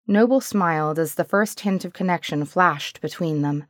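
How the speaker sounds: ordinary delivery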